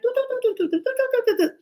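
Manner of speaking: high mock birdsong chatter; bird chatter. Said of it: "they're chatting".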